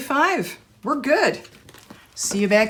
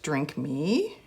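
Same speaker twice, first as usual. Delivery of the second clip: lower, softer, stretched "Drink me." with a rise; hushed drawn-out read; 6 semitones lower, 6 dB quieter than usual. Real, not acted. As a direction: breathe "Drink me." low and soft like a spell. Rereading label, drawn out.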